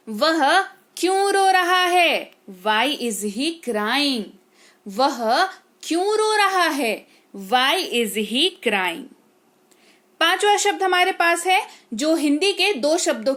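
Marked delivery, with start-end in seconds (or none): 2.7-4.2 very slow, clear teaching read; slow model sentence. Shown as a demonstration. Teacher modelling sentence slowly for students. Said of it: "teacher models English sentence". 7.4-9.1 very slow, clear teaching read; slow model sentence. Shown as a demonstration. Teacher modelling sentence slowly for students. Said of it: "teacher repeats model sentence".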